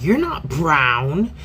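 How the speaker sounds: lightly voiced friend, said slowly; a hint of the friend, drawn out; friend's flat correction. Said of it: "she was like".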